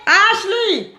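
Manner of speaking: high calling voice across the room. Real, not acted. Vlogger calling Ashley from across the room, high call.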